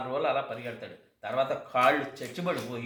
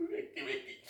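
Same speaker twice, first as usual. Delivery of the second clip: soft breathy mock sobs; a hammed-up whimper; mock sobbing; 8 dB quieter than usual. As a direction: fake a hiccuping sob, softly. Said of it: "అని ఏడుస్తా".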